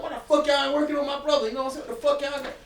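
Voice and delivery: high, loud mock rant; cussing out. Demanding staff treat his brother, high angry cussing. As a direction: yell at hospital staff. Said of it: "I'm cussing out the".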